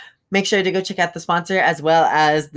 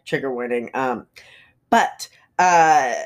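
usual speaking voice

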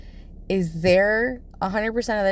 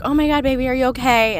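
louder, higher, worried outburst; alarmed mom; 7 semitones higher, 5 dB louder, faster than usual. Retold or imitated. Mom finds her screaming child, retold alarm. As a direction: rush in alarmed to check on your child.

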